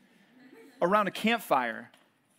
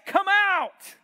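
high, loud "come out!" yelled into the furnace; king's shout; 13 semitones higher, 6 dB louder than usual. Acted. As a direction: call "come out!" at full voice to the men in the fire. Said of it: "walk up to the door of the furnace and say".

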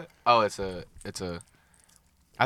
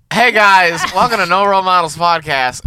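upbeat host intro, louder; podcast intro; 4 semitones higher, 8 dB louder, slower than usual. Acted. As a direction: announce the show like a host. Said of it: "Did you even intro your ca-, your cast?".

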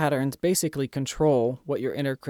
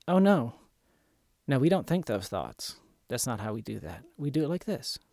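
kind, gentle correction. Shown as a demonstration. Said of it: "with kindness, respect, and understanding".

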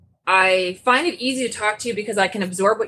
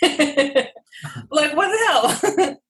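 high, slow laughing disbelief; giggling what the hell, pitched up high; 6 semitones higher, much slower than usual. Real, not acted. Cracking up at the conversation.

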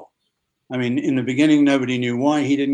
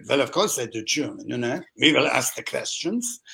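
badly faked German accent; terrible German accent. Actor proving he can do German, in terrible German accent. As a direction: put on a hammy, poor German accent. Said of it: "This is my terrible German".

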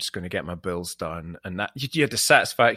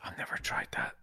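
whispered; 2 semitones lower, 11 dB quieter than usual. Real, not acted. Intrigued by lavender tea.

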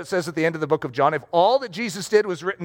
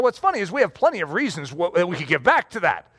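ordinary talk, nothing marked

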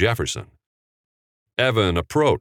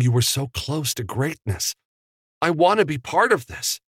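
much higher, breathless with wonder; eager young Evan; 9 semitones higher than usual. A narrator's reading. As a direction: gush about history like a starstruck fan.

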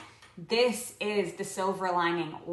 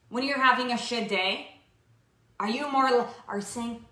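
normal talking voice

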